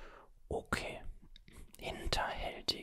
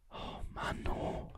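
ordinary talk, nothing marked

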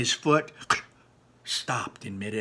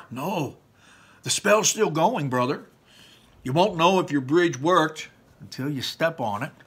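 a hint of a higher, louder big brother; cheerful older brother explaining; big brother Barley; 5 semitones higher, 5 dB louder than usual. Barley urging his brother to trust the spell, lightly voiced.

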